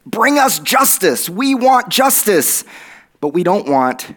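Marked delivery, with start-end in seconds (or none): 0.1-2.7 high, slow plea for justice; pleading demand. Retold or imitated. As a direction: cry out for justice, drawn out. Voiced demand of the people.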